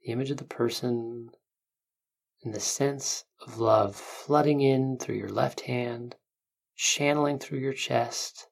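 calm guiding voice, unhurried; guided meditation. Acted.

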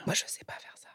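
unvoiced confessing whisper. Real, not acted. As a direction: whisper it like a secret. Confessing aside.

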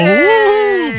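high-pitched cheering whoop; celebratory whoop. Real, not acted. Host cheers contestant's right answer, celebratory whoop. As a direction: whoop for a winner.

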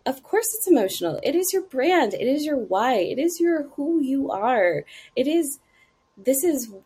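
earnest, high-pitched insistence; emphatic reassurance. Retold or imitated. Acted emphatic reply to apologetic clients.

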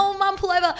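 high, loud excited squeal. Retold or imitated. Telling mum to stop the car, high excited squeal. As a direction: blurt it out thrilled.

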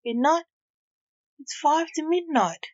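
high, tearful disbelief; slight sob. Acted. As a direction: protest through mild tears. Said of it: "Vivienne sobbed slightly".

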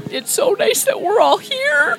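put-on high, slow, teary old man; weepy grandpa. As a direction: choke up like a sentimental grandpa. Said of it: "In, like".

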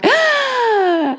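put-on high gasping "Ah!" of a thrilled baby; baby's excited gasp. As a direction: gasp like a toddler in suspense.

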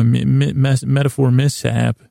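ordinary delivery

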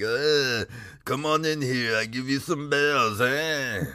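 high, strained alien shopkeeper voice; Watto voice. Retold or imitated. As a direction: play the wheedling junk dealer. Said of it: "that voice hurts so bad to do".

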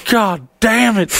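high-pitched mock despair; hammed-up desperate whine; despairing friend. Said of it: "the disparity in his voice".

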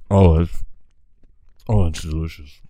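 savouring mouth full; savouring mouthful. Real, not acted. Savouring the bite on mic.

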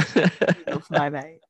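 slow, slurred I love my mate; a mild drunken slur; drunk catchphrase. Retold or imitated. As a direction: say it tipsy and sentimental. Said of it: "take the piss out of me for saying this".

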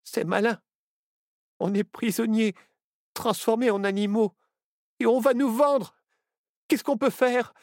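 high-pitched, dismayed character voice; distraught young fox. A narrator's reading. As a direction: lament being trapped. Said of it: "Nao Renard était effondré".

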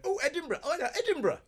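high-pitched mock Scottish presenter. Imitating a presenter from Scotland hearing Edinburgh, Scottish. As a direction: imitate an excited Scot, voice shooting right up. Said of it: "'cause she's, like, from, she's, she's the Scotland".

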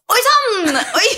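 high, loud startled whoa; man behind her startled by her fall; startled exclamation. Retold or imitated. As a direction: react to someone tumbling down the stairs. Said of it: "han bak meg sånn".

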